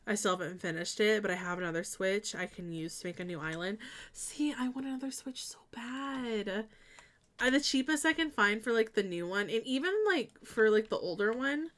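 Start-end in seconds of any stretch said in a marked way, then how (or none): 4.1-6.6 whispered longing aside. Real, not acted. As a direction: breathe it like a secret wish.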